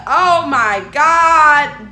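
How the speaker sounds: loud, long drawn-out exclamation; retold shocked outcry. Storyteller reliving the painful shock to a friend, loud outcry. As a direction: cry it out loud, stretching each word.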